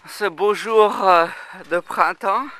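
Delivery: bouncing while talking; on a trampoline. Real, not acted. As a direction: voice jolted by bounces. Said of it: "on est sur le trampoline là".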